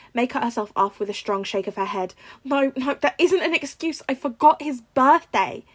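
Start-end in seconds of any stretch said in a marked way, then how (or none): 2.4-5.6 high, loud self-reproach; loud, tearful guilt; aunt refusing excuses, high and upset; distraught. A narrator's reading. May blaming herself.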